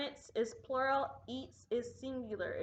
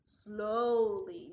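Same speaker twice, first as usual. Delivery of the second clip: long drawn-out SLOWLY; 4 dB louder than usual. Shown as a demonstration. Teacher recalling how pupils said their names, slowly. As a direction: stretch the word out. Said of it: "You said it slowly".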